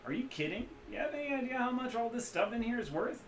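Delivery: put-on Midwestern accent. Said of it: "loose Midwestern accent".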